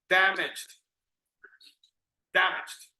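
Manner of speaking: damaged as "DAM-ijd", stress on the first syllable; damaged said as a separate, careful word; model pronunciation. Shown as a demonstration. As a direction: model damaged carefully with a short final d sound. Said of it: "It's almost three sounds".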